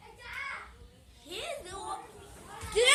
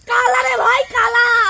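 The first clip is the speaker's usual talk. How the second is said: angry shout; 16 dB louder, much slower than usual. Acted. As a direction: bellow it in anger. Shouting back in quarrel.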